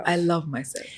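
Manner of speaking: hushed echo; quiet self-affirmation; quiet repeat. Shown as a demonstration. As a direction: repeat it softly back. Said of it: "and say".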